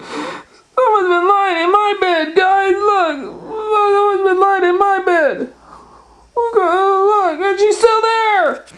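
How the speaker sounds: very high, loud squeaky wail; tiny bear crying out at top pitch; Baby Bear. Acted. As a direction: squeal it like an alarmed little bear cub. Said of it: "And then Baby Bear said".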